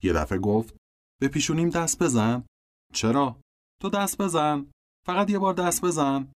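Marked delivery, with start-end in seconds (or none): 1.2-6.3 put-on lightly raised, childlike little-girl voice; a hint of an eager little girl pleading; little sister Phoebe. A narrator's reading. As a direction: sister coaxing her brother to touch her forehead.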